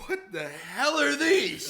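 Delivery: high, drawn-out mock outrage; alarmed dad. Dad confronting child over syringes, mock alarm. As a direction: play a scandalised parent, hammed up.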